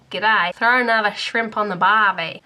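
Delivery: a hint of a badly done Australian accent. Shown as a demonstration. Showing viewers her terrible Australian accent. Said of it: "I'm just terrible at accents".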